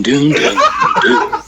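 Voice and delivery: breaking into laughter mid-song; laughing. Real, not acted. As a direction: laugh through the last notes. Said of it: "I can't even do it anymore".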